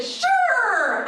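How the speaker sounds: high, loud bright yes; cheery sure. Retold or imitated. Wife cheerfully agreeing to husband's request, high chirp. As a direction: chirp it with fake enthusiasm. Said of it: "and I said".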